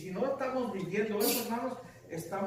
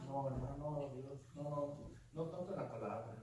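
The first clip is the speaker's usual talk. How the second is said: softer, lower sheepish excuse; mock counselee reply; 7 semitones lower, 12 dB quieter than usual. Pastor voicing a counselee's sheepish answer. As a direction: play the church member caught out.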